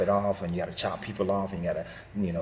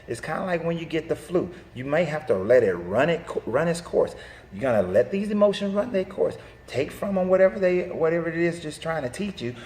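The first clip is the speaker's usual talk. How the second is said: higher, louder, urgent parental lecture; parent lecturing; 6 semitones higher, 3 dB louder than usual. Retold or imitated. Mother lecturing her kids on the walk to the corner, earnest. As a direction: coach the kids through their feelings. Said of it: "then I tell them".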